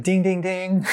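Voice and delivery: high sing-song bell chime; mock bell ding. Realizing at last, chiming a high mock bell. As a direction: chime it like a game-show bell. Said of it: "And I was like".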